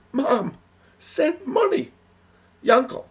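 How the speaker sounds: very high, loud, spaced-out words; plaintive plea. Acted. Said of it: "It's how we say things".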